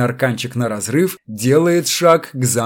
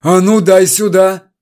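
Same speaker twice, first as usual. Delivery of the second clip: higher, louder snatching order; snatch it away from him; angry demand; 6 semitones higher, 6 dB louder than usual. A narrator's reading. Electrician snatching the paper, angry demand.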